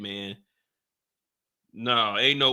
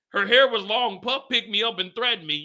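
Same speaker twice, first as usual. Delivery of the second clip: mock quoting witness; 8 semitones higher than usual.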